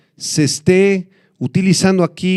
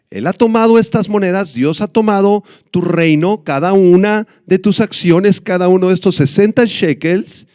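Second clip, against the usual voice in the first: higher, rising fervent sermon delivery; preaching; 4 semitones higher than usual. Acted. Preacher announcing God's judgment to Belsasar, fervent. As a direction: build the verdict with growing force.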